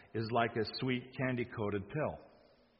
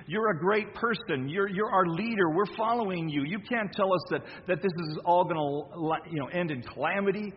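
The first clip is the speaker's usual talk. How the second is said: raised, urgent protest to his master; pleading Peter; 6 semitones higher, 3 dB louder than usual. Acted. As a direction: plead with Jesus as a worried follower. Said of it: "Peter pulled him aside and said".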